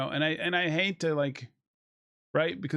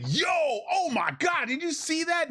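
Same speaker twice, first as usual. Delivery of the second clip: much higher, amazed casual outburst; excited normal guy; 11 semitones higher than usual. Retold or imitated. The kind of line he wishes characters said, in a high excited yell. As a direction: blurt it like a hyped buddy. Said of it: "no one in this film's like".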